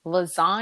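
lasagna with a long open "zahn"; specific lasagna. Retold or imitated. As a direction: imitate the co-host, leaning on lasagna's middle vowel. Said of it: "say it in a very specific way".

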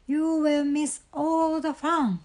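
high-pitched protest; mild storybook outcry; crying out. A narrator's reading. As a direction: plead with a friend, pitched high. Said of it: "cried the Frog".

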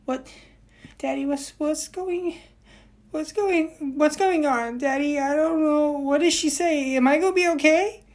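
put-on high, anxious child's pleading; scared little boy impression. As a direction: panicky kid tugging his dad. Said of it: "he's going".

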